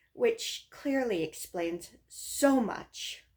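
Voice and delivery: loud, bouncy verse with punched stresses; limerick recitation. Acted. Reading own limerick about a bad day, loud emphatic recitation. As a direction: hit the rhymes hard, drawing out the last line.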